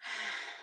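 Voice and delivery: long slow breath out with a sigh; slow deep breaths. Shown as a demonstration. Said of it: "let's breathe in through our nose for five".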